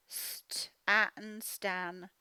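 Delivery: St-atten stretched out very slowly, then Stan; slow decoding. Shown as a demonstration. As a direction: sound it out slowly, then blend. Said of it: "sounding out then blending".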